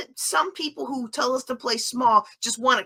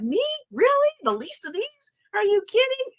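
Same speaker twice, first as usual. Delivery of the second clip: high, incredulous little questions; mock disbelief; 11 semitones higher than usual. Speaker replaying her amazement at being called, mock disbelief. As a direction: squeak it out as if astonished to be chosen. Acts out own astonishment at calling.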